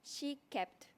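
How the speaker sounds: usual speaking voice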